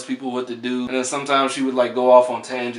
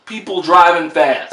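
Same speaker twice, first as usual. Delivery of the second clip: louder, higher mock Oscar announcement; mock awards announcer; 4 semitones higher, 7 dB louder than usual. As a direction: lightly announce it like an awards presenter. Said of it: "the Oscars to say".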